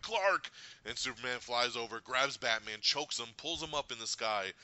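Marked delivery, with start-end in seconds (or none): 0.0-0.5 calling out. Retold or imitated. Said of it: "he's like".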